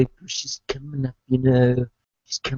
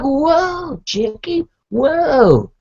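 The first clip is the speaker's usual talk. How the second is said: high, worked-up protest about coconut; hammed-up outrage over the order; indignant excitement; 9 semitones higher than usual. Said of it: "And I'm like".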